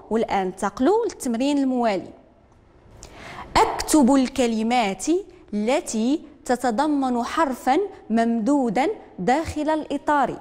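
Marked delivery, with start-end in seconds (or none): 3.6-10.4 slow, deliberate reading of the instruction; teacher dictating the task word by word; slow dictation. Shown as a demonstration. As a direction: read it out slowly for young pupils. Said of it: "teacher dictates the instruction".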